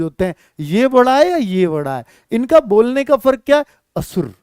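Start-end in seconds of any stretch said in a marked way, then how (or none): 4.0-4.3 said quickly. Shown as a demonstration. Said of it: "ये जल्दी बोलेगा असुर".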